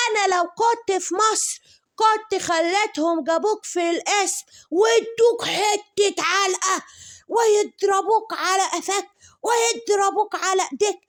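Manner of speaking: very high, loud, slow screeching threat; hammed-up furious shriek. Mocking her threatening the Baron, screeching high and slow. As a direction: screech every threat at him. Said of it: "عاملة للبارون إزاي".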